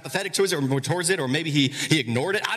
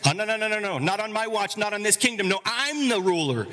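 high, raised, mock-furious Herod; fuming king; 8 semitones higher than usual. Herod refusing a rival king, mock-furious. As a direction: rant as a jealous tyrant. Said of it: "He was fuming".

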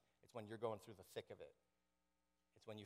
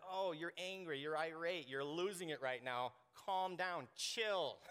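higher, condescending soothing; mock patronizing calm-down; 4 semitones higher, 4 dB louder, slower than usual. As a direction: talk down to someone who is fuming. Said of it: "They're like".

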